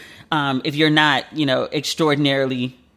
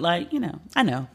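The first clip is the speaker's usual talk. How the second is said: ordinary delivery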